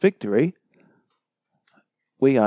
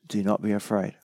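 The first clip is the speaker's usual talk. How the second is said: much softer, tender words to a frightened man; soft reassurance; 2 semitones lower, 7 dB quieter than usual. Acted. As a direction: hush it like comforting someone. Voicing Jesus' reassurance.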